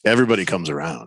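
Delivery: low, menacing calm; ominous. Real, not acted. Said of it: "That was almost ominous".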